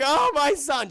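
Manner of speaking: high, loud, hammed-up relief; mock happy mother. Wife reunited with her son, mock-overjoyed cry. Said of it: "She's all happy and shit".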